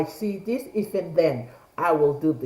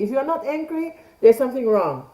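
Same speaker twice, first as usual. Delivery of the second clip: ordinary delivery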